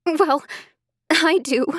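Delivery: high, choked-up reply. Acted. Emily admitting to Sarah, choked and high. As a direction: force it out through tears. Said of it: "I choked out".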